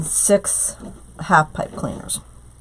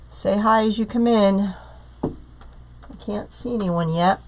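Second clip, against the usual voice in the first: ordinary talk, nothing marked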